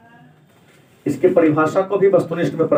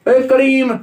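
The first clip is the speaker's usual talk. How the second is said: calling out; 6 semitones higher, much slower than usual. Shown as a demonstration. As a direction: call across to someone, higher. Teacher calls out example name.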